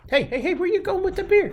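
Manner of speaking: mock alarm. Real, not acted. Teasing someone leaving with beer.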